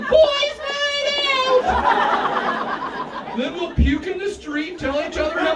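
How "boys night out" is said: high, long-held hammed-up holler; party yell. Boys throwing hands up in the air, drawn-out party yell. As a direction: stretch it into a whooping party cry.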